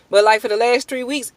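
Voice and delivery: usual speaking voice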